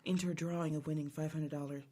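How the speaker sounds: mock-seductive low pitch; sultry female [name]. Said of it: "You gotta be deeper than that".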